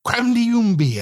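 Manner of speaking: high, silly call that slides down; playful nonsense cry. A narrator's reading. Narrator prancing around with Meg, playful high cry. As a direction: pitch it up like a goofy cry. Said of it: "I go".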